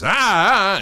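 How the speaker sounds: high-pitched mock "aah" wail; mock wild outburst. Mocking men who go off in a dispute, high wailing. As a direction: imitate someone going off wildly, way up high. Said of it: "they just be going all".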